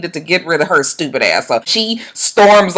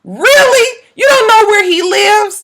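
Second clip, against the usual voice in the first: high, loud incredulous cry; can't believe what she just heard; shocked disbelief; 11 semitones higher, 3 dB louder, slower than usual. Real, not acted. Disbelief at the contestant.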